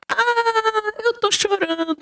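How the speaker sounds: high, lightly voiced tearful grandma; hint of a weepy old woman; grandma answering her grandson, mildly tearful; a touch of mock crying grandma.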